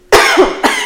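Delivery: sudden sharp cough; a real choking cough. Real, not acted. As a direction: cough as if something caught in the throat. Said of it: "choking a little bit".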